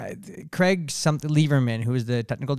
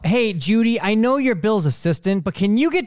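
higher, louder chirpy boss voice; mock boss on the phone; 7 semitones higher, 4 dB louder than usual. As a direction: play an overly cheerful manager. Imagined boss phone call.

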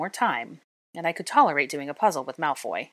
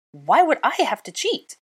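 higher, louder indignant question; outraged yelp; 9 semitones higher, 7 dB louder than usual. A narrator's reading. Hermione outraged at Draco's cheating charge. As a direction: flung back in disbelief. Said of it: "I yelped".

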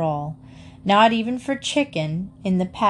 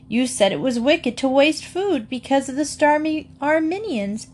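put-on higher, mildly reproachful child; 7 semitones higher than usual. A narrator's reading. Emily reproaches Mamma about wasting food, higher and mildly hurt. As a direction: remind her mother of her own rule. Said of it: "said Emily reproachfully".

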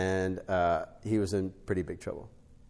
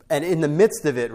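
emphatic; 6 semitones higher, 10 dB louder than usual. Real, not acted. Preaching burst.

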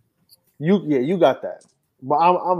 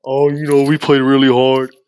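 mocking Kobe-style postgame humility, louder; hammed-up Kobe interview voice; 2 semitones lower, 8 dB louder than usual. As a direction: play the humble superstar in a postgame presser. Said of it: "he put on the Kobe voice".

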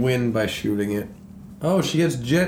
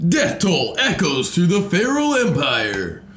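monster truck ad; 6 semitones higher than usual. Pretend. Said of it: "reads to me like a monster truck ad".

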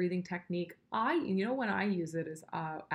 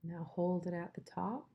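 guided breathing. Shown as a demonstration. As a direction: lead the breath gently. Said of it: "let's just do it once together".

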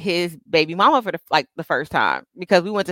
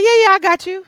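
put-on eager boyfriend; 11 semitones higher, 4 dB louder than usual. Boyfriend quoted agreeing on phone.

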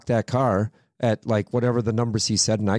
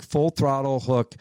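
ordinary talk

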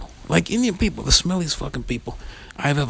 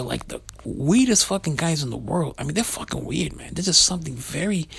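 usual speaking voice

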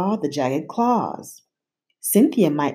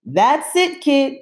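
higher, louder gruff monster voice; grunted; 9 semitones higher, 4 dB louder than usual. A narrator's reading. Gabe the monster warning Emma, gruff. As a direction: lose patience with the kid. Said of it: "he grunted".

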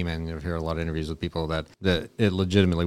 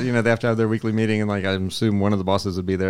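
normal talking voice

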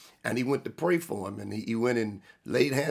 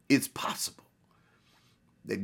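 hushed whisper; 2 semitones lower, 3 dB quieter than usual. Acted. Dramatic aside in sermon.

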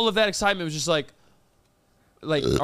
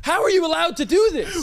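higher, louder demand for the supervisor; indignant demand; 8 semitones higher, 3 dB louder than usual. Pretend. To the corn dog stand, outraged customer demanding a manager.

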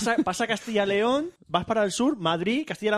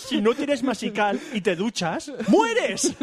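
mock ad; 6 semitones higher, slower than usual. Mock ad dramatic.

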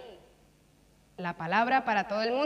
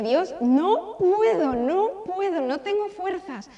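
higher and louder, pleading; retold tearful plea, raised voice; desperate crying prayer; 6 semitones higher, 7 dB louder than usual. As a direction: cry out to God that you can't go on. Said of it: "me puse a llorar y le dije".

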